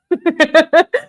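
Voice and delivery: high, loud laugh; real laugh. Real, not acted. Host joking to viewers about drinking, high loud laugh.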